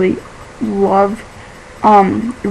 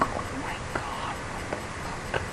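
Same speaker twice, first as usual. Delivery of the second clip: hushed, breathless whisper of disbelief; shocked whisper; 20 dB quieter, slower than usual. Retold or imitated. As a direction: whisper it like you can't believe your eyes. Shocked aside at girl writing in blood.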